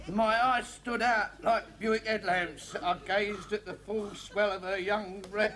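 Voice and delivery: slow, halting read-aloud; laborious reading. Acted. Patient reading the racy novel himself, slow laboured reading. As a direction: drag through it word by word.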